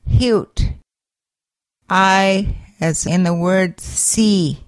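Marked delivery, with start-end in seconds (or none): 0.1-0.7 "hute" with a breathy h as in happy; h sound. Shown as a demonstration. Teacher modelling the letter H for learners, breathy h. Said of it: "H in the word happy".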